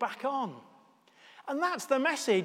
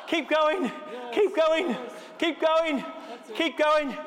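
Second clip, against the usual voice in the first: shouting encouragement; 7 semitones higher, slower than usual. Real, not acted. Vicar urging Bethany to keep pedalling, shouting. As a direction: yell her on, don't let her stop.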